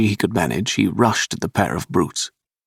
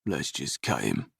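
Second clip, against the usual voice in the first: low, much softer menacing murmur; whisper; 5 semitones lower, 11 dB quieter than usual. A narrator's reading. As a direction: mutter it low and hushed so no one hears. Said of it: "the bearish man whispered".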